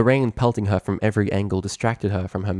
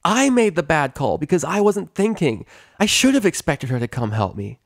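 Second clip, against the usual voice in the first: higher, louder, stubborn protest; brash pegasus; 8 semitones higher, 4 dB louder than usual. Acted. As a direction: own the mistake loudly. Rainbow arguing with Celestia.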